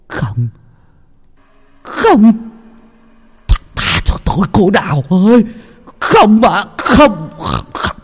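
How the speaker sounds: high, desperate begging cries; terrified pleading. Acted. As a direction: beg for your life in a nightmare. Nightmare, begging Đào for mercy. (van xin hoảng loạn, giọng cao)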